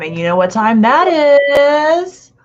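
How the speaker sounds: hype announcer. Acted. Show intro hype question.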